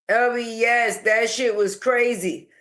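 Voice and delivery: ordinary talk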